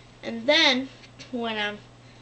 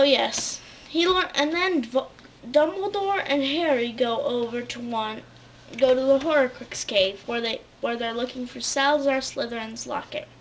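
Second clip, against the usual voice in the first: ordinary talk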